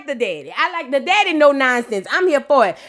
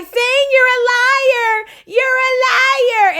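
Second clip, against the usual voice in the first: high, slow mock accusation; accusing girl; 10 semitones higher, much slower than usual. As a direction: drag out each word of the accusation. Girl calling Zane liar.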